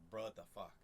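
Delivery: "Bro, what the fuck?" blended into two quick chunks; native casual inflection. Shown as a demonstration. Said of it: "that's, like, our inflection".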